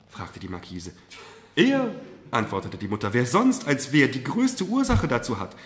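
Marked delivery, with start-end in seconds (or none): 1.6-5.6 loud, high, pointed answer; emphatic mother. Acted.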